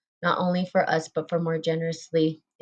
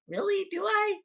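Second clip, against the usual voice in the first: mock self-doubt; 14 semitones higher, 5 dB quieter than usual. Doubting her own claim.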